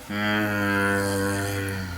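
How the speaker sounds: low, soft drawn-out "mmm"; quiet monotone undead moan; zombie groan. Retold or imitated. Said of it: "To which I replied".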